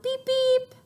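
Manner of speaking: beep. Shown as a demonstration. Imitating car horns for the children. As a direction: toot like a car horn.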